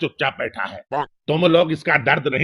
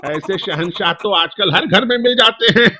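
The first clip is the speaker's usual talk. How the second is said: usual speaking voice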